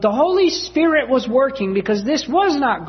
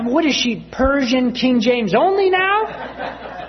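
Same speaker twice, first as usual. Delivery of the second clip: higher, slow, laughing incredulous question; laughing disbelief; 6 semitones higher, slower than usual. Real, not acted. Preacher joking to congregation about his mother, slow laughing disbelief. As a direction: stretch it out in amused disbelief.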